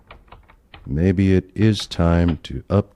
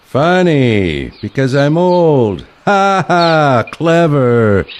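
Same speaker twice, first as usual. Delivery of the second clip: loud, higher, dry sarcasm with a mock laugh; sarcastic; 8 semitones higher, 7 dB louder, slower than usual. Grandpa mocking his friend's old-age joke with loud sarcasm. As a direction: throw the joke back at him, louder and higher.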